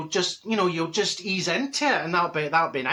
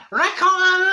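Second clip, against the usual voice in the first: high, loud Bianca-style Ricotta; rough impression of Bianca; 12 semitones higher, 7 dB louder, slower than usual. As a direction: shriek it like Bianca. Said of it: "she was always going".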